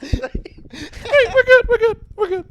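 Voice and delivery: high, nervous "it's fine" voice; mock reassurance. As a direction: fake calm through a squeak.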